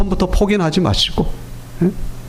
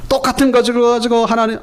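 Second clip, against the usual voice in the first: ordinary talk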